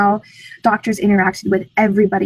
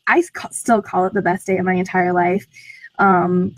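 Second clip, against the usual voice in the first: ordinary talk, nothing marked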